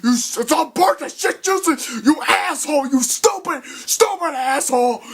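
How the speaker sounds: high-pitched yelled insults; hammed-up villain tirade; angry berating. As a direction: tear into him, shrill with rage.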